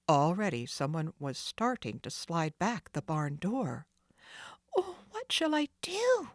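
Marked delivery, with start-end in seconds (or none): 4.8-6.3 put-on high, anxious girl's voice; a trapped girl's worried cry; despairing Nancy. Trapped Nancy hears the thieves, despairing. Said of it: "Nancy thought in despair".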